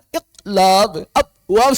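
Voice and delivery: loud, forced "Iqlab, abwab" with dead stops; "Iqlab, abwab" broken at each blocked consonant; without qalqalah. Shown as a demonstration. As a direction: strain at "Iqlab, abwab", no bounce on q or b. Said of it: "nggak pakai qalqalah gimana?".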